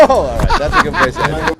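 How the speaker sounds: high laughing through words; real laughter, high and loud. Real, not acted. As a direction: laugh while wrapping up. Host cracks up at clip.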